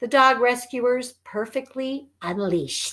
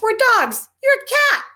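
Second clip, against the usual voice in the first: taunting dogs; 12 semitones higher, 5 dB louder than usual. Acted. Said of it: "They're like".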